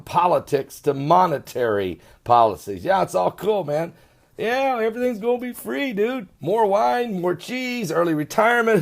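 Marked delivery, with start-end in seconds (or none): 2.8-8.8 higher, lazy mocking dude voice; mock carefree slacker. Host mocking French voters as laid-back dudes, lightly voiced.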